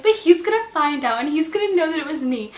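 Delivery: high, loud, fretful protest; imitating herself begging her friends in a high panic; retold panic. Panicked teen pleading with friends, high and loud. Panicking that the crush will know.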